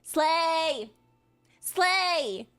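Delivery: ordinary talk, nothing marked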